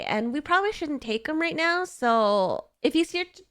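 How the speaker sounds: usual speaking voice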